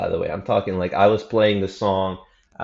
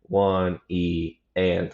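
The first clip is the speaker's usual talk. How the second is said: unhurried, drawn-out beat count; slow counting; much slower than usual. Shown as a demonstration. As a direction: count the subdivisions slowly. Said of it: "I'm playing at thirty beats per minute".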